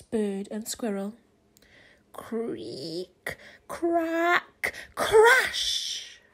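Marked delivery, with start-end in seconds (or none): 2.1-6.2 creak crack crash effects. A narrator's reading. Narrator voices the house giving way, building crash. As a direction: let it creak then smash.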